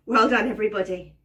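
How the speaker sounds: usual speaking voice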